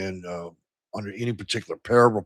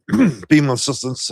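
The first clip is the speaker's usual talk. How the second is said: choking a bit; 4 semitones higher, 5 dB louder than usual. Real, not acted. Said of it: "sorry, I'm choking a bit this morning".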